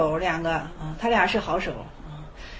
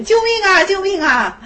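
high, loud cry for help; calling for help; 7 semitones higher, 3 dB louder than usual. Retold or imitated. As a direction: cry out as if in danger. (高声呼喊“救命”)

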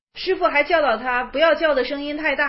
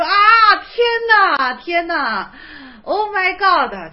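shocked exclamation; 4 semitones higher, 6 dB louder, slower than usual. Retold or imitated. Retelling monk's shocked exclamation.

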